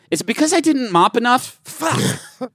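high-pitched, hammed-up teen complaint; mock teen protest. Acting a pleading, refused teenager.

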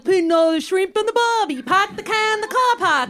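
mangled mock-Australian accent. Shown as a demonstration. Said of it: "so Australian is like".

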